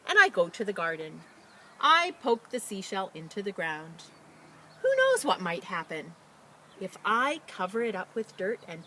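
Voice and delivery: expressive picture-book read-aloud; reading to children. Acted. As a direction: read it out brightly to a class. Teacher reads picture book aloud.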